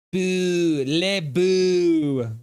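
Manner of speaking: high, drawn-out mock-French booing. Shown as a demonstration. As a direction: stretch the boo out in mock French. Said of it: "That's how you say it".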